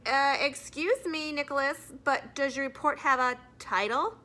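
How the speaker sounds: a prim teacher cutting in, drawing it out; teacher interrupting a nervous pupil. Acted. Mrs Granger interrupts Nick.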